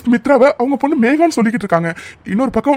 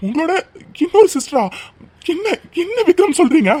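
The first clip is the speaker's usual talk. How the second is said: ordinary talk, nothing marked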